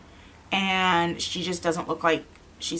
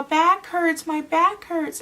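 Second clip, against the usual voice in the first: put-on high, loud little-kid complaint; retold child's pained wail, pitched up; hurt child; 11 semitones higher, 5 dB louder, much slower than usual. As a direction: imitate a child crying out in pain. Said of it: "And he's all".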